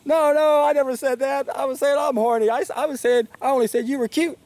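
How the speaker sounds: a flustered Frasier backpedalling fast; flustered protest. Retold or imitated. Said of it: "Fraser goes".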